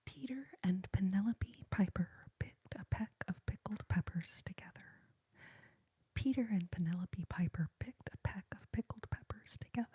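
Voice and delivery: whispered tongue twister with crisp popping P sounds; tingly whispered "pickled peppers"; ASMR trigger words. Shown as a demonstration. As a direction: pop every P of "Peter" and "Piper" softly into the mic. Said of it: "What are some other good trigger words".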